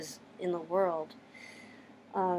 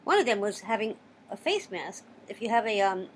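ordinary talk, nothing marked

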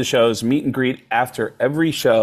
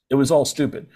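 normal talking voice

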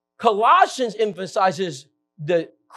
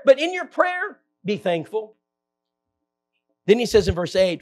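ordinary talk, nothing marked

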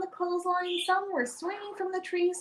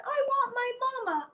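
sobbed; 7 semitones higher than usual. Acted. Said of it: "I sobbed".

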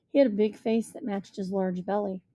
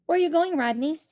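calling out; 7 semitones higher, 3 dB louder than usual. A narrator's reading. Rishi calls out to Rodney.

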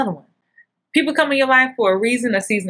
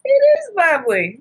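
high, mock-gushing agreement; stroking his ego. Wife humoring husband's ego, high and gushing. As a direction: fawn over him, pitched way up. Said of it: "gotta stroke the ego".

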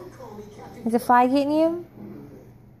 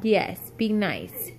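slow low stern warning; sharp playground warning; 5 semitones lower, 5 dB quieter, slower than usual. Real, not acted. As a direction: lay down the rule slowly. Parent warns misbehaving child.

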